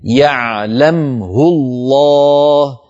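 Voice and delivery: slow tajweed chant; Quran recitation. Shown as a demonstration. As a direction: recite the verse melodiously and slowly. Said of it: "كقوله تعالى".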